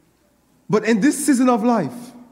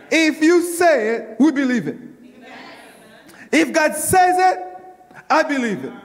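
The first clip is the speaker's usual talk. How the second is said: preaching; 6 semitones higher than usual. Real, not acted. Call and response preaching.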